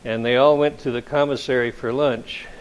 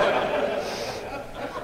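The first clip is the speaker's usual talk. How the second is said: laugh; 7 dB quieter than usual. Real, not acted. Author laughing.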